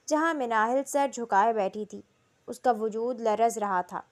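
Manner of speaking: ordinary delivery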